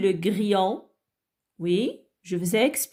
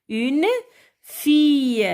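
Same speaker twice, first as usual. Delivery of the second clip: Une, pause, higher louder fille; syllable by syllable; 4 semitones higher, 3 dB louder, slower than usual. Shown as a demonstration. Teacher helping children read, Une and fille in separate beats. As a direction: isolate Une and stretch fille. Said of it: "découper les mots en syllabes".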